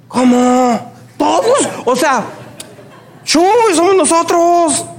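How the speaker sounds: high, disbelieving cry of surprise; mock the friends' shock at his refusal; imitating shocked friends.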